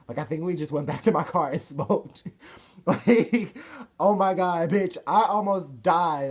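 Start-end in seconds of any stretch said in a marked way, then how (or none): 2.9-6.3 loud, high, dramatic outburst; frantic exclamation. Retold or imitated. Driver telling a friend about nearly dying, frantic exclamation. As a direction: blurt it out high and loud, hammed-up alarm.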